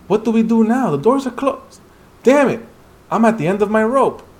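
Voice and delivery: trapped hunter crying out in panic; panicked cry. Acted. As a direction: at the end of his rope, shouting.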